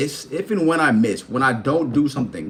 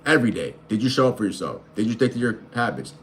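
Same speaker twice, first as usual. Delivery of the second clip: ordinary delivery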